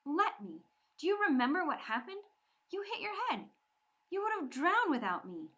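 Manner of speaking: high, loud scornful retort. Acted. Bree scorning Mikkel's boast, high and scornful. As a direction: scoff at his claim. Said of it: "Bree's laugh was hard with scorn".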